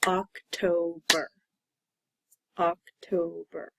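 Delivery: OCTOBER slowly, broken as oc-to-ber; slow syllable-by-syllable October; counting syllables. Shown as a demonstration. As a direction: stretch OCTOBER into three separate beats. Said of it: "How many syllables".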